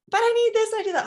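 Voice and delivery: put-on high, loud singsong child whine; mock child whine. Mom mimicking her child's singsong protest. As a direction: sing it like a whining toddler. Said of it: "sing things like".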